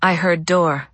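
plain reading voice

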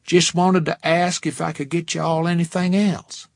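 put-on friendly Texas accent, laid on thick. A narrator's reading. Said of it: "in a Texas accent that was thick enough to cut".

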